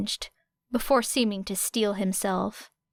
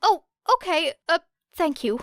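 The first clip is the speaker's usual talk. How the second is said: put-on a higher, relieved boy's voice; a nervous boy thanking them; lightly voiced relieved young boy; 8 semitones higher, 4 dB louder, faster than usual. A narrator's reading. Midoriya relieved.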